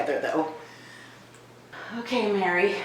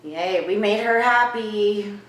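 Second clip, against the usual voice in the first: ordinary talk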